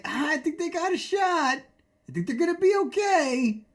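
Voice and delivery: high, airy mock of Kenny. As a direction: put on a carefree Kenny voice. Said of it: "He's like".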